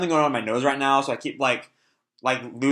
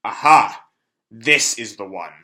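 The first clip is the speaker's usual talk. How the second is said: loud aha, then a slow drawn-out reveal; lightly voiced demon; demon finding his prey; 3 semitones lower, 6 dB louder, slower than usual. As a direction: savour finding the one. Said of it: "he notices real R C L".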